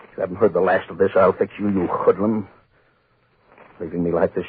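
ordinary delivery

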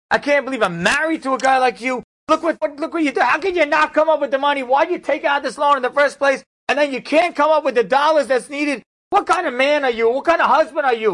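high, loud, furious berating; furious wife. Retold or imitated. Wife flips out on her husband, furious high yell. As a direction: scream it at him, high and shrill with contempt. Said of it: "flips out on him".

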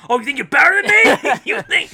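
loud, high indignant challenge; mock confrontational. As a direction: pick a fight, hammed-up outrage. Acting guy confronting a happy stranger.

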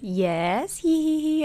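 drawn-out yes into high titter; imitated giggly mom. Mom admits there are peanuts, put-on giggle. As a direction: imitate a mother pleased with herself.

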